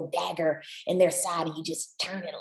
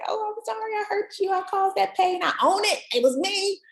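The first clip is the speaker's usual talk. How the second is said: high-pitched pleading apology; high, sing-song contrite owning-up; acted apology; 11 semitones higher than usual. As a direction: own up in a high, put-on voice. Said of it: "I just need you to say".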